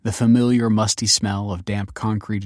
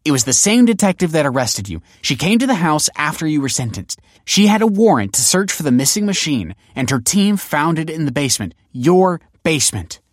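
raised, louder, exasperated voice; fuming explanation; 8 semitones higher, 6 dB louder than usual. A narrator's reading. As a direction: lay out the facts accusingly. Fuming daughter keeps explaining, accusing.

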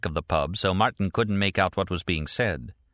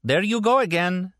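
restrained shout over a passing siren; raised voice; 12 semitones higher, 3 dB louder than usual. A narrator's reading. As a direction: call out to be heard, pitched way up. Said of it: "Stella had to shout to be heard".

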